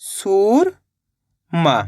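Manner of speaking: a teacher stretching Surma slowly for the class; drawn-out blended word. Shown as a demonstration. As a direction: stretch Surma very slowly, each sound held. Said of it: "तो मिलके बन जाएगा".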